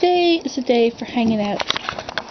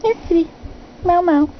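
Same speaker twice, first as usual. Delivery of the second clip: high-pitched pet-calling voice; coaxing the cat; 7 semitones higher than usual. Real, not acted. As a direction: sweet-talk a cat into coming over.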